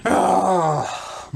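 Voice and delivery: normal talking voice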